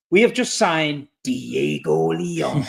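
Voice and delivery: low, very slow announcer impression; movie trailer voice. Host teases a signing as a film trailer, deep slow announcer voice. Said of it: "that voice comes on".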